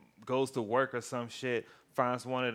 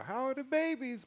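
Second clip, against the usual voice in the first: nosy neighbor. Retold or imitated. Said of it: "Neighbors coming over".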